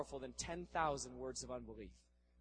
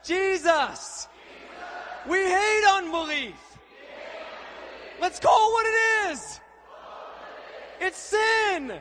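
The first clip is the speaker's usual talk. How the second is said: roaring, drawn-out call and response; shouted prayer; 13 semitones higher, 7 dB louder, slower than usual. Real, not acted. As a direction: lead the crowd at full voice. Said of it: "just say this after me".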